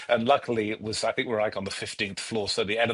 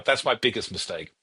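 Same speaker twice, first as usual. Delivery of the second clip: ordinary talk, nothing marked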